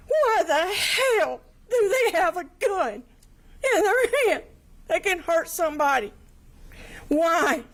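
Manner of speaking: rising high with held-back tears; begging for an answer through tears; tearful pleading question. Real, not acted. Victim asks the court why, near tears.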